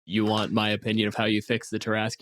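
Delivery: normal talking voice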